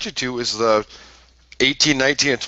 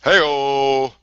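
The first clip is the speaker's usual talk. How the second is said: imitated rallying call; 2 semitones higher, 3 dB louder than usual. Imitating Hacksaw's patriotic wrestling call.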